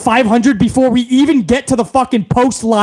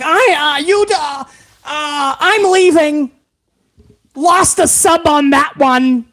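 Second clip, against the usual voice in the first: high, stammering huff; hammed-up high flustered exit; mock offended viewer; 7 semitones higher, slower than usual. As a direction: play the sulking fan storming off. Mocking a viewer who quits.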